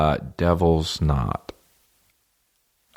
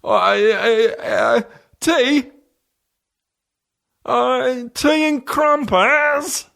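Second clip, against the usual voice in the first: very high, slow, hammed-up British voice; British stutter; much slower than usual. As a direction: stretch a stammered British plea painfully long. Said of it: "That's how British he is".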